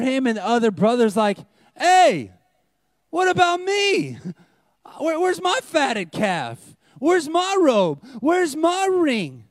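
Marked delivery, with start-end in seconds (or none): 1.7-9.4 high, slow, indignant complaining; mock-sulky whine about what he's owed; whiny jealous brother.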